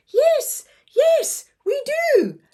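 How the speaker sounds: urgent little sister agreeing; young girl voice. Acted. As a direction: squeak it like an eager eleven-year-old.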